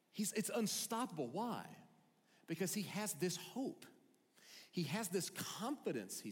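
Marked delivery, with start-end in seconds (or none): none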